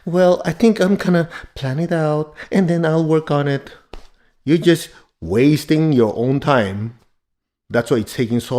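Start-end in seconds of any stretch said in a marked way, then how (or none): 0.0-3.7 higher-pitched, put-on gentle voice. Shown as a demonstration. Teacher parodying gentle planning talk, overly gentle. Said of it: "if you make it so gentle".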